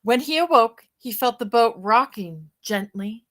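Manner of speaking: put-on bright, lively read-aloud for children; storybook reading. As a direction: read it like a bedtime picture book to kids. Reads picture book aloud.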